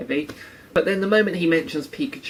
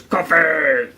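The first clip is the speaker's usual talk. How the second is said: louder, throaty creature call of its name; Pokemon cry; 4 dB louder than usual. Retold or imitated. Imitating Koffing's cry for viewers, throaty call. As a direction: bark out the name like a monster. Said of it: "He goes".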